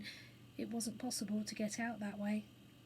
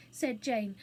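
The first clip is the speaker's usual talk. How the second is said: usual speaking voice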